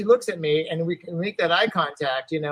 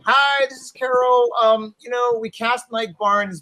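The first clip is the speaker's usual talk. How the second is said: casting voicemail; 8 semitones higher, 5 dB louder, slower than usual. Retold or imitated. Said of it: "I get a message on my answering machine".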